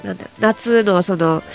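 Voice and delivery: usual speaking voice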